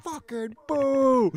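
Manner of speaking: put-on slow, high, loud Philly-accented jeer. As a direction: stretch the boo out like a Philly heckler. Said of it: "got that Philly accent".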